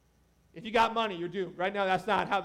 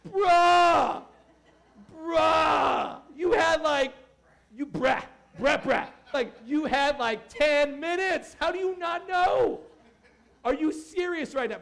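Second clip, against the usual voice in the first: loud, higher, fed-up yelling; exasperated shout; 5 semitones higher, 5 dB louder, slower than usual. Retold or imitated. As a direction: shout at the clueless customer ahead in line. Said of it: "And you're like".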